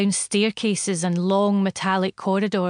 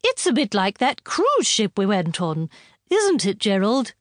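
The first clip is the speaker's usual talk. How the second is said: female voice. A narrator's reading. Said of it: "said a female voice".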